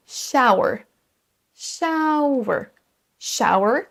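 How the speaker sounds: shower as sha-oo-uh; careful model of shower; A-OU-E glide. Shown as a demonstration. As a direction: stretch shower through a, ou, e. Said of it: "Et la même chose pour".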